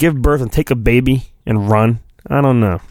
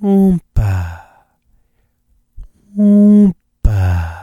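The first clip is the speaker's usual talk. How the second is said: mock oompa; 3 semitones higher, much slower than usual. Birth sound effect.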